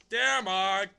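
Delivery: loud, high mock-dramatic reading; over-the-top sing-song reading; funny letter reading. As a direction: belt out the greeting like a silly recital. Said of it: "I read them a funny way".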